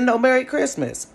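high, loud knowing wish; hint he's forgiving it; insistent cheer. Retold or imitated. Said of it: "He said".

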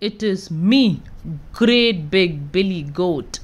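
low, strong big goat; low confident goat. A narrator's reading. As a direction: answer like a big bold goat. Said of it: "The big goat replies".

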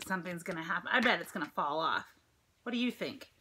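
ordinary delivery

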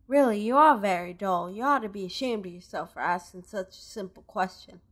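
lightly voiced Southern woman merchant; Southern accent. Robbed merchant explains to the player, light Southern accent. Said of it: "kinda doing the Southern voice thing again".

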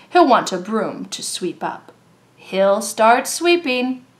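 usual speaking voice

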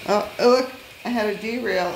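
sudden high surprised exclamation; surprised cry. Real, not acted. Hobbyist watching his toy train derail, surprised. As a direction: caught off guard by the derail.

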